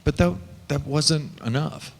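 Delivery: ordinary delivery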